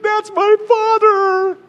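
very high, slow reveal; shocked Esther. Acted. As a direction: drop the bombshell in a high voice. Esther reveals Mordecai to Haman.

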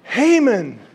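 the king accuses Haman, slow and indignant; outraged king. Acted. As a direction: thunder at him like a wronged king. King catches Haman with queen.

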